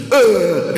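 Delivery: loud, high mock "ugh" of fright; grandmother's startled yelp. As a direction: imitate a grandmother recoiling from snakes. Acting out grandmother's fright at snakes.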